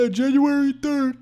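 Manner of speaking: high, emphatic mock betting call; loud, high mock proclamation; hyped-up crowd imitation. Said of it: "Everybody's like".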